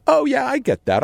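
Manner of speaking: high, casual voice; Robin's breezy agreement. Retold or imitated. Girlfriend Robin agreeing breezily in a high, casual voice. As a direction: toss it off lightly. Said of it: "she goes".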